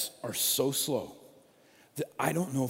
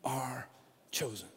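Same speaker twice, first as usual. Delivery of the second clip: hushed emphasis; 3 semitones lower, 8 dB quieter, slower than usual. Acted. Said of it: "Paul says".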